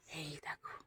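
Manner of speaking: hushed unvoiced whisper. Real, not acted. Showing viewers the room lighting up, whispered. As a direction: breathe it out with no voice. Room brightening as windows open.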